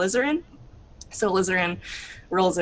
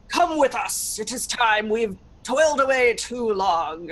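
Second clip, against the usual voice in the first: stern, formal summons; lightly voiced stern guard; soldier command; 4 semitones higher than usual. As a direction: order her out ceremonially.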